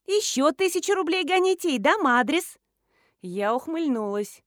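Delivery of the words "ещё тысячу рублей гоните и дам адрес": put-on high, loud girlish demand; young extortionist. A narrator's reading. Schoolgirl raises her price for the address, brazen girlish voice. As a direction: demand more money brazenly. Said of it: "вымогательница".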